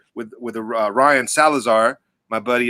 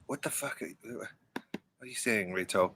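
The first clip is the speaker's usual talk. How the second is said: very soft, low-key question; hushed, halting question to a friend; quiet asking; 4 semitones lower, 17 dB quieter than usual. Real, not acted. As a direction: ask it under your breath. Asks Reto, first take, barely audible.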